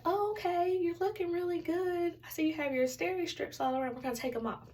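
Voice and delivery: high-pitched upbeat nurse, easing down at the end; high sing-song check-up voice; nurse practitioner. Retold or imitated. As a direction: imitate a chirpy, reassuring nurse. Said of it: "and she's like".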